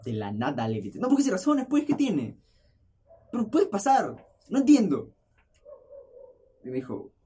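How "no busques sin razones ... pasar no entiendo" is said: loud high mock yell at the neighbor; retold yell. Neighbor asks him to move the car, loud retold yell. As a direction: throw it at him, higher and louder.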